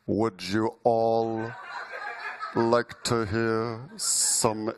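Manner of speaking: very slow, low villain drawl; mock-ominous voice. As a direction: draw each word out low like a sinister professor. Mock-ominous act at the microphone.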